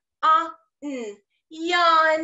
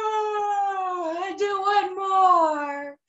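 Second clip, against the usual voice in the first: slow, high, talking through a yawn; 5 semitones higher, much slower than usual. Shown as a demonstration. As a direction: stretch the words out in a big yawn. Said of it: "And I was yawning".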